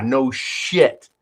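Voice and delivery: normal talking voice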